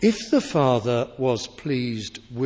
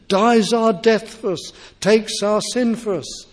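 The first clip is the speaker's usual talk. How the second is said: much louder, higher, building proclamation; climactic preaching; 5 semitones higher, 4 dB louder than usual. Acted. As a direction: build to a triumphant pulpit peak.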